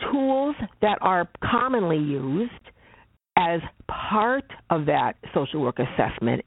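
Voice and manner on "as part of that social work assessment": "part" punched out, set apart after "as"; emphatic "part", rest of the phrase running on evenly; stress on part. Real, not acted. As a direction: lean firmly on the word "part". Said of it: "I'm emphasizing the word part".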